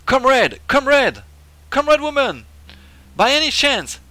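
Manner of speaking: high, loud comic comrade; game character voice. Acted. Player voices an old official greeting a comrade, hammed-up high voice. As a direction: call out high and loud with big falling swoops.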